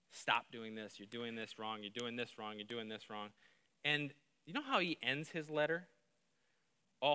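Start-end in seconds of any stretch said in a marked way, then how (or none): 0.1-3.3 low, soft mock scolding; hushed scolding. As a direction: murmur the rebukes like a tired reproach. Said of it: "He spends eleven chapters beating this church up".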